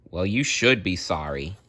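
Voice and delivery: ordinary delivery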